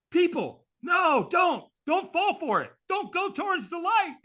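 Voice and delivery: hammed-up shout of warning; mock warning shout. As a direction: cries out to the crowd of thirty-five hundred to stop. Warning crowd not to fall.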